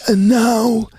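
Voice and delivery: slow, high hushed nature-documentary narration; breathless wildlife narrator, slow and high; David Attenborough impression. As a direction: narrate the antelope like a wildlife presenter. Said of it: "He's the voice".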